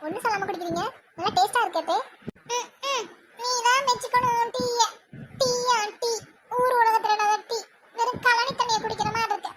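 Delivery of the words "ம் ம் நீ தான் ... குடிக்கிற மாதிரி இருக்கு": high-pitched scornful put-down. Acted. Mother-in-law rubbishes daughter-in-law's tea, scornful. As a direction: run down the tea with disdain.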